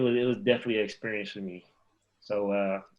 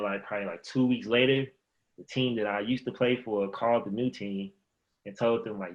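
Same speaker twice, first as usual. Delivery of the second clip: ordinary delivery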